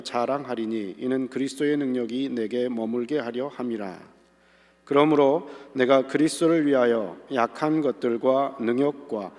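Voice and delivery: measured, solemn Bible reading; scripture reading. Acted. Reads scripture aloud.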